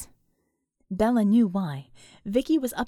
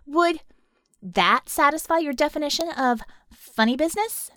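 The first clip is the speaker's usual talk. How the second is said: higher, louder cheeky question; raised-voice challenge; 4 semitones higher, 4 dB louder than usual. Acted. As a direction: call the question across to them. Masked girl shouts from outside.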